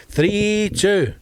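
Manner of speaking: "Three two" pitched high and held; playful high number. Pretend. Playing with the episode number.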